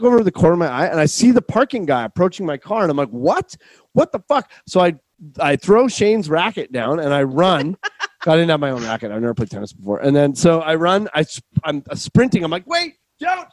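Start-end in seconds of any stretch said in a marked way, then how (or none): 3.1-4.5 retold shock, pitch jumping high; alarmed disbelief. Player spots the parking guy at his car, alarmed. 12.7-13.5 very high, strained mock plea; panicked calling out. Driver sprinting to stop the parking guy, panicked.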